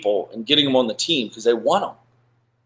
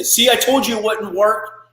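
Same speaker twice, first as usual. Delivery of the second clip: smug told-you-so; 9 semitones higher than usual. Retold or imitated. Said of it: "'cause I gotta make my ego right".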